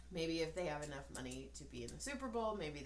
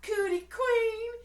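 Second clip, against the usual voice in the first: high, loud, slowed comic insult; hammed-up shrill comeback; mock insult; 10 dB louder than usual. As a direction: squeal the insult like an offended wife. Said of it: "she's all".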